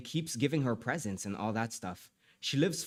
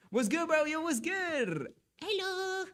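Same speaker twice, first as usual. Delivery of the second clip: playful bro greeting; slower than usual. Pretend.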